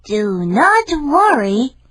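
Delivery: slow, high soothing voice; calm him down gently; reassuring cat. Acted. Cat soothes his worried master, slow and high.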